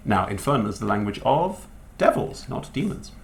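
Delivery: normal talking voice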